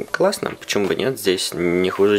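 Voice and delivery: ordinary delivery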